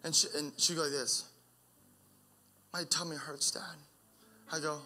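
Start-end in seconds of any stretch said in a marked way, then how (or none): 2.7-3.6 put-on whispered small-child complaint; whispered little girl. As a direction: whisper it like a toddler with a tummy ache. Father voicing his small daughter.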